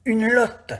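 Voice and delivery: high, loud call across the river; excited shout of the catch; shouted answer. Acted. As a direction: yell it back to the shepherd.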